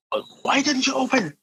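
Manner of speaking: high-pitched imitation of an old man; old landlord's complaint. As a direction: whine it like a put-out old landlord. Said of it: "kinda Woody Allen looking guy".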